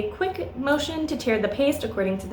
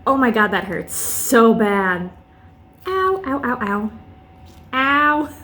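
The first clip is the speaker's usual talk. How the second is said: real pain, yelping ow; slower than usual. Real, not acted. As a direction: cry out from the sting. Ripping wax off her arm.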